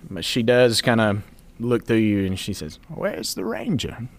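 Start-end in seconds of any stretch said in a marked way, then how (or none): 2.9-4.1 put-on high, slow old lady voice; old woman voice. Opoly asks for ranger.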